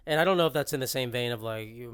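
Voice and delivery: usual speaking voice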